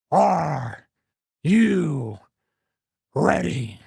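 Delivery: drawn-out ring announcer call with long pauses; stretched hype call with a dramatic gap before each word. Shown as a demonstration. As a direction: build it up like a big arena intro. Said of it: "Did I do it right?".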